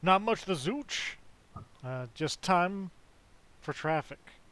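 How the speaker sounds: goofy radio host voice; hammed-up morning DJ bit; silly clown. Playing morning radio hosts.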